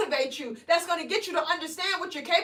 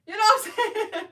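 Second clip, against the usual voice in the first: laughing through the words, higher and louder; 6 semitones higher, 4 dB louder, faster than usual. Real, not acted. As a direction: let the giggle break into the line. Laughing mid-sentence.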